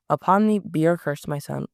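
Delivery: plain reading voice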